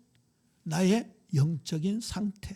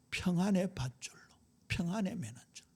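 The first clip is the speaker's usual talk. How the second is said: whispered lyric; 5 dB quieter than usual. Acted. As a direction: murmur the phrase like a secret. 가사를 속삭이듯 읊조림. (속삭이듯 읊는 가사)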